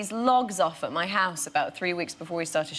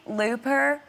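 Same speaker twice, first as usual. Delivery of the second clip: higher questioning Southern twang; Midwest Southern accent; 6 semitones higher than usual. Retold or imitated. Local queries the film's title, Southern accent. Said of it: "sounds a little bit Midwest and Southern".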